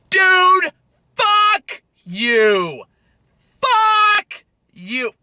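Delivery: unload real rage at the screen; furious yelling. Real, not acted. Yelling in his car at a hated scene, furious.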